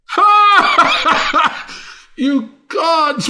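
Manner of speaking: a high, loud cackle of disbelief; mad laugh. Acted. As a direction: burst out laughing at the gods. Laughing fit inside the rant.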